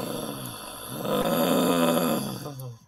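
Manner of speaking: deep weary sigh; long exasperated sigh. Real, not acted. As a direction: let out a long groan of a sigh. Exasperated at the author.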